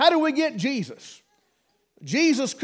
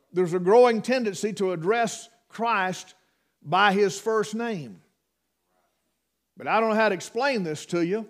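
ordinary talk, nothing marked

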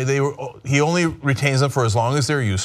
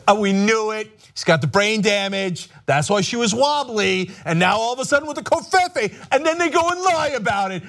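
high sneering put-on voice; mocking critics; 6 semitones higher than usual. Host mocking Hillary critics, high sneering put-on voice. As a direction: sneer it in a pitched-up gossip voice. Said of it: "you know what everybody would say".

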